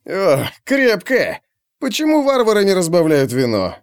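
complain, reeling from a big gulp; high, mildly grumbling complaint. A narrator's reading. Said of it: "проворчал он".

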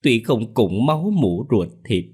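high, hammed-up keening lament; daughter-in-law faking tears at the funeral; fake wailing. A narrator's reading. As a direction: overplay the grief for show. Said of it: "cũng ý ới nào là".

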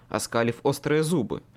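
ordinary delivery, nothing marked

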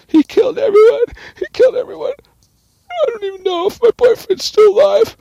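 loud, very high wailed lament; mock hysterical screaming. Lucky interviewed by detectives, mock hysterical screaming. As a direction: screech it like a hammed-up distraught victim. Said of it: "Lucky screaming like a".

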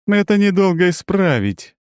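loud, high threat; menacing. A narrator's reading. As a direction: promise trouble coldly. Цири threatens the copy.

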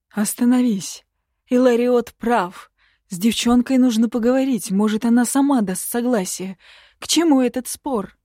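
high, loud plea to stop; urgent intervention. A narrator's reading. Lord intervenes urgently in the quarrel.

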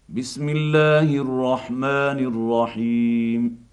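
ordinary delivery

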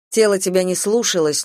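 ordinary delivery

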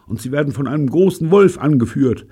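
loud, high panicky protest; frightened. A narrator's reading. Fritz warns them about the wolf pack, frightened. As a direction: plead against it in alarm. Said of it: "Fritz hatte Angst".

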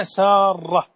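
سارة said as Saarrah, r held; doubled r. Shown as a demonstration. Sheikh correcting the name, سارة with doubled r. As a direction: lean on a long rolled "rr" in سارة. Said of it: "بتشديد الراء".

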